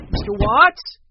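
loud, high questioning call. A narrator's reading. Henry knocking at the cabin door, calling out. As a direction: call through a closed door. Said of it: "he called".

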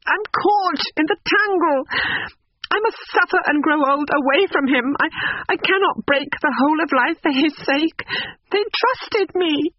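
high-pitched anguished lament; tearful sobbing. A narrator's reading. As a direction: act a distraught young woman, voice pitched very high. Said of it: "She fell sobbing into the chair".

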